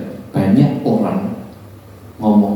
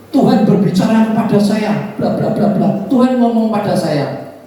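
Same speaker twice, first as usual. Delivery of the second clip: mocking pious claim; 12 semitones higher than usual. Preacher mocks people who boast God speaks, mock-pious. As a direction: parody the self-proclaimed prophet. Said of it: "mocking people claiming God speaks".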